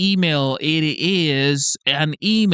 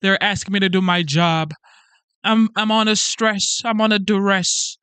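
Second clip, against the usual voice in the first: mock biblical prophet; 3 semitones higher than usual. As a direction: wail like a put-upon martyr. Mocking whining federal worker.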